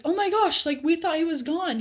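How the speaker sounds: high, loud imitated astonishment; amazed. Sisters seeing Lazarus walk out of the tomb, amazed. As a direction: gush it as the astonished onlookers. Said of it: "it would still be amazing".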